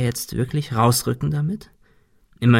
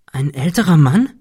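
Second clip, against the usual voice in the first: higher, mildly startled question; astonished, concerned; 4 semitones higher, 4 dB louder than usual. A narrator's reading. Cecil questions frightened Shirley, astonished and concerned. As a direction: ask in worried surprise. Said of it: "fragte Cecil erstaunt und besorgt".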